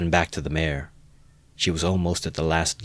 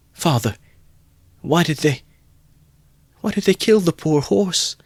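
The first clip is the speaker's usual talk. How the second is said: high, louder broken sob; sobbing; 11 semitones higher, 5 dB louder than usual. A narrator's reading. Boy pleading with his father about the horse, sobbing. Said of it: "he sobbed".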